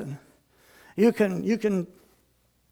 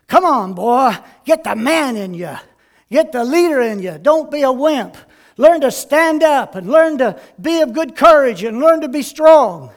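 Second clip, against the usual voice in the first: loud, forceful goading, building louder; rousing fatherly urging; 4 semitones higher, 8 dB louder than usual. Acted. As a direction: egg the boy on, voice rising. Acted dad goading his wrestling son.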